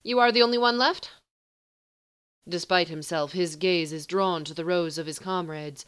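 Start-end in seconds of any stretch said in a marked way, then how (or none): 0.0-1.1 higher, louder quick question; haughty sorcerer. Acted. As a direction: ask with detached curiosity. Sorcerer asks, high fast.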